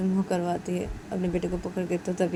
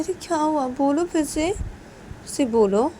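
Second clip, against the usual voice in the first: coaxing parent; 9 semitones higher, 4 dB louder, slower than usual. Acted. Parent urging baby to repeat.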